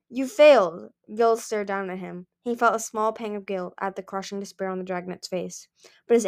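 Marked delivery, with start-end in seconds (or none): 0.1-0.9 loud, higher condemning line; cold verdict. Acted. Gil rejecting his son, a loud cold verdict. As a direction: drop it on him like a sentence.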